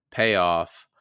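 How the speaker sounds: usual speaking voice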